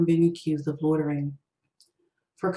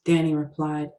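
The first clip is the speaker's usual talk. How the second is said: usual speaking voice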